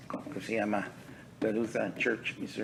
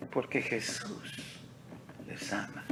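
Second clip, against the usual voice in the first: hushed preaching; 9 dB quieter than usual. Real, not acted. Hushed answer to his shouted question.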